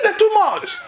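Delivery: high excited shout of praise. Retold or imitated. Urobo man praising the Indian doctors, high excited shout. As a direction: cry it out high in delight. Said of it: "He said".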